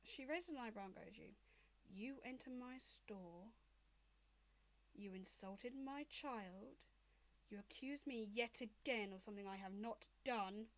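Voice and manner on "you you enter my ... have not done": lightly voiced angry dragon mother.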